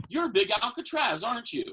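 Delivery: a fan blurting the wrong thing; confused fan. Retold or imitated. Woman's mistaken punchline.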